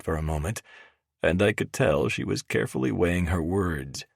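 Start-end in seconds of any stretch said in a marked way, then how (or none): none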